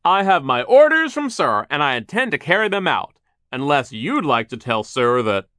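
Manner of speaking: loud, high, forceful insistence; bossy foreman. Acted. Foreman cites orders from Sir, loud and bossy. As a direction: lay down the law at full voice. Foreman argues, threatening Charles.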